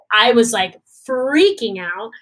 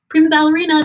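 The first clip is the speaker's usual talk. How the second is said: loud, higher, emphatic announcement; childhood declaration; 5 semitones higher, 5 dB louder, much faster than usual. Retold or imitated. Young self declaring a ballet dream, emphatically. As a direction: proclaim it like a settled destiny. Voices her childhood ambition.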